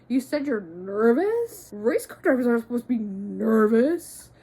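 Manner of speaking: loud, incredulous media scoff; scornful mockery. Media tearing into a nervous young driver, scornful mockery. Mocking media quoted as ripping him.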